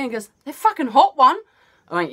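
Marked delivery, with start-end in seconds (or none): 0.4-1.4 high, loud incredulous question; can't believe it, loud; disbelieving cousin. Retold or imitated.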